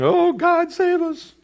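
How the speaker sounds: high, loud mock plea; desperate prayer. Disciples praying in the storm-tossed boat, high and pleading. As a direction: wail it like a panicked prayer. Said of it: "they're praying".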